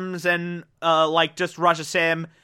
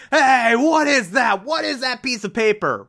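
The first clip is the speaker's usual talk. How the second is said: higher, louder demand, hitting what; suspicious shout; 6 semitones higher, 3 dB louder than usual. Retold or imitated. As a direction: bark at the kid over the paper. Jacker spots the paper.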